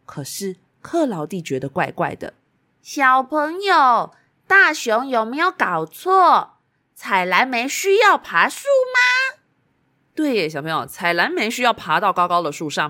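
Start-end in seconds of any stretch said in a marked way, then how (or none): 2.8-9.4 put-on high, slow, whiny little girl. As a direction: ask it like a worried little girl. Cloudy doubts the bear, voiced.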